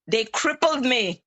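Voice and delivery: ordinary delivery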